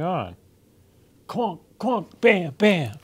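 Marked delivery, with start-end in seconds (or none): 1.3-3.0 piano banging noises. Acted. Kitten bangs the piano at night.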